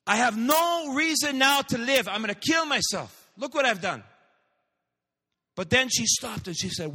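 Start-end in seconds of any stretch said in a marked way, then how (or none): 0.1-4.0 high despairing cry; desperate shout of regret; anguished outburst. Acted. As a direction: wail it out in despair. Despairing girl cries out.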